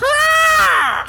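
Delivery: guttural mock grah roar; monster roar. Host voicing the alien lunging at Samus, a mock roar.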